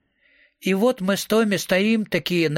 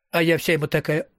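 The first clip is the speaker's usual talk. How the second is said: usual speaking voice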